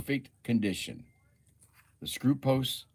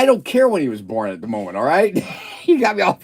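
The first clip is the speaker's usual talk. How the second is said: louder, laughing protest; 8 semitones higher, 14 dB louder than usual. Real, not acted. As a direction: laugh it off while pushing back. Laughing at being sidetracked.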